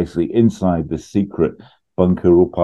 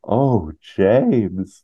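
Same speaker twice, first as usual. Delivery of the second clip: breathy mock swoon; 4 semitones higher than usual. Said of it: "Kimber give it a".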